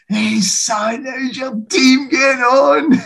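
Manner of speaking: put-on high, broad Glaswegian accent, slow and cheery; broad Glaswegian accent, pitched well up, ribbing a young colleague; Bertie Auld impression. Former player teasing a Celtic TV colleague, high broad Glaswegian accent. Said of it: "that broad Glaswegian accent that he's got".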